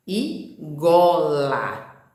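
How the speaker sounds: gola drawn out slowly with a voiced G; very slow gola with a clear G; slow word demo. Shown as a demonstration. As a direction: stretch gola out for the children. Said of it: "a gente tem que continuar atento a isso".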